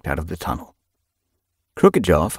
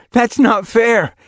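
playful protest; 7 dB louder, slower than usual. A narrator's reading.

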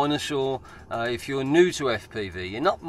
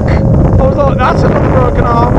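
much higher and louder, adrenaline shouting; relieved shout; 13 dB louder than usual. Real, not acted. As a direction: yell it out after a near crash. After a close call flying.

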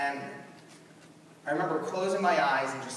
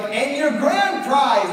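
game show host; 7 semitones higher, 8 dB louder, slower than usual. Retold or imitated. Game show host announcing prize.

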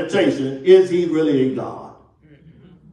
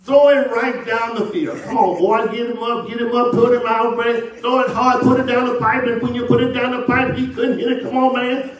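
higher-pitched, rapid-fire chatter from the outfield; mock outfield heckling, pitched up; ballfield yelling; 4 semitones higher than usual. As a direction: re-enact yelling encouragement to the pitcher. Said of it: "I would be yelling from".

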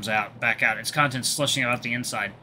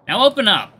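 bossy high character voice; insist, a little impatient; commanding medic; 9 semitones higher, 8 dB louder than usual. Acted. Ink insists again.

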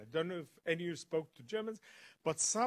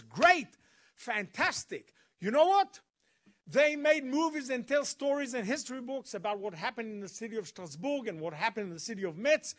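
loud, high excited burst; 5 semitones higher, 4 dB louder than usual. Retold or imitated. Guard thrilled about the documentary, loud excited gush. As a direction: gush with sudden enthusiasm. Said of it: "suddenly he gets all excited".

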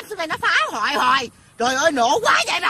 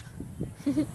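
soft, breathy little laugh; quiet chuckle; 13 dB quieter than usual. Real, not acted. Woman amused by her own rant, quiet chuckle.

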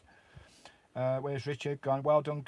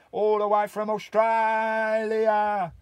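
higher, louder, slow stretched-out call; excited call; 7 semitones higher, 7 dB louder, much slower than usual. Real, not acted. Hailing a viewer who joined from Australia, excited. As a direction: stretch it out like big news.

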